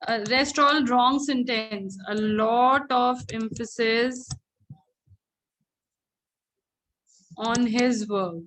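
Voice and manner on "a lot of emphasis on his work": dictation. Shown as a demonstration. Teacher dictates an example sentence.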